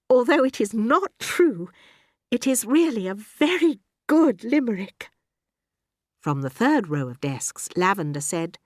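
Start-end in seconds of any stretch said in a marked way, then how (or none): 0.1-5.1 lightly voiced teacher, pitched up; a hint of a prim schoolteacher, higher; kindly teacher. A narrator's reading. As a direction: praise with emphatic, measured phrasing. Voices Miss Honey in the story.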